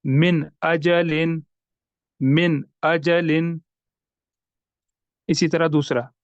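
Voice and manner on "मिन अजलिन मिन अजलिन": "मिन अजलिन" slow, न of मिन sounded fully; careful recitation, no nasal sound; clear noon. Shown as a demonstration. Teacher repeats the clear reading for students. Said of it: "नून साकिन को हमने जाहिर करके पढ़ा".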